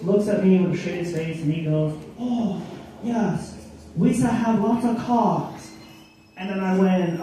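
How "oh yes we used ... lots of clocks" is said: higher-pitched imitation of an old shopkeeper.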